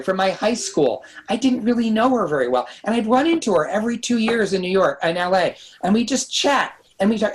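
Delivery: normal talking voice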